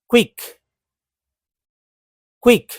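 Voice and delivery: क्विक said cleanly as kwik, teacher modelling; model pronunciation. Shown as a demonstration. Teacher models क्विक for students, clear and careful. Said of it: "ये क्विक कैसे हो गया".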